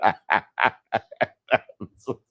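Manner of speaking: apologising through helpless laughter; laughing apology. Real, not acted. Host apologising to listeners mid-fit, laughing. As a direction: barely get the apology out for laughing. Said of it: "I'm laughing".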